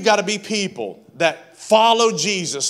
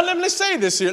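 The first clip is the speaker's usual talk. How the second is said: ordinary talk, nothing marked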